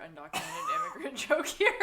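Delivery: reading it out through laughter; can't stop laughing mid-line. Real, not acted.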